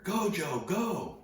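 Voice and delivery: high-pitched shout urging someone on; retold excited holler; urgent call. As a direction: imitate a surfer calling out across the waves. Retelling a surfer calling him on.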